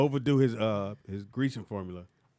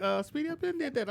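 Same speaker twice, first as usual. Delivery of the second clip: high mock voice. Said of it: "you were like".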